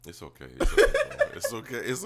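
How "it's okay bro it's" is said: squealing through laughter; high laughing reassurance; mock comfort while cracking up. Real, not acted.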